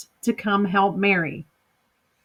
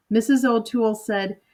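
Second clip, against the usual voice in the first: plain reading voice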